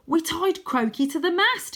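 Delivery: high gleeful yell; shouting. Acted. Wyn shouting back over the waves, high gleeful shout. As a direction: shout it out with glee. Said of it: "he shouts".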